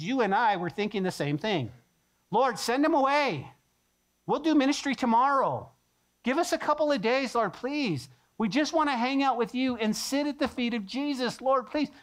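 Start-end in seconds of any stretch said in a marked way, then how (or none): 2.3-11.9 higher, whining plea to the Lord; mock-whiny disciples begging for rest; pleading disciples. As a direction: beg the Lord for a break. Disciples voiced pleading to Jesus.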